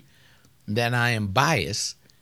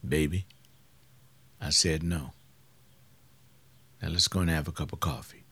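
lower, softer, unhurried refusal; calm husband; 8 semitones lower, 6 dB quieter than usual. Retold or imitated. Preacher voicing husband gently refusing wife, low and soft. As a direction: soothe her while holding firm.

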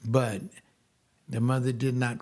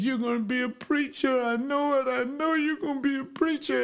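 lightly voiced grandma, higher and sure; hint of an old woman foretelling, pitched up; grandma voiced higher, insisting; grandma's prophecy; 11 semitones higher than usual. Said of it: "She said".

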